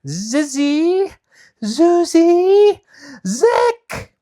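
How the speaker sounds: climbing high shouted charm; shouted spell. Acted. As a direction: shout the last magic words. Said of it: "stood on both feet and shouted".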